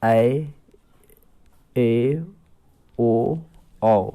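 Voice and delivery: teacher pronouncing compound vowels; compound vowel demo. Shown as a demonstration. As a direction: sound out ऐ, ए, ओ, औ with pauses. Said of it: "संयुक्त स्वर".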